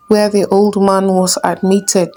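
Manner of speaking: usual speaking voice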